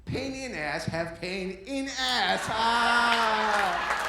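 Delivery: loud, high impression of a gleeful wife; hammed-up high-pitched taunting; mocking wife. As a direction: jeer at full volume, ending in a drawn-out cry. Acts out wife mocking his pain.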